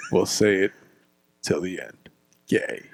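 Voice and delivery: soft low singsong; hushed teasing. Pretend. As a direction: whisper it like a secret.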